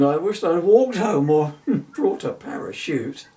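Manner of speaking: loud, mock-groaning complaint; hammed-up grumble about the ride; groaning Bill. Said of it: "He groaned".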